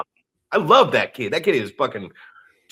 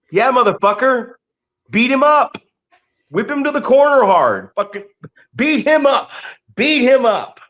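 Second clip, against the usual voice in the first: heated urging; 7 semitones higher than usual. Retold or imitated. Urging the heel to attack.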